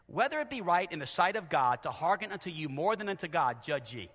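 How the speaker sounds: ordinary talk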